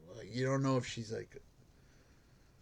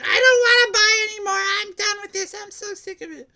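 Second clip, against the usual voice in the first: very high, loud falsetto mock yell; mock yelling woman; 12 dB louder than usual. Guard imitates homeless woman yelling, high falsetto mock yell. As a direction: ham up her yelling at a phone. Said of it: "she starts yelling".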